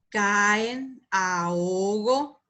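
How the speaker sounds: slow "Caen, ahogo", each vowel its own syllable; split hiatus. Shown as a demonstration. Teacher showing hiatus examples, vowels slowly separated. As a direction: pull apart ca-en and a-ho-go.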